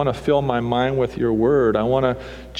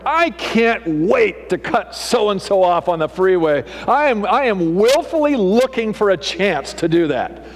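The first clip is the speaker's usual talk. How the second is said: mock scheming driver; 6 semitones higher than usual. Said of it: "waking up that morning going".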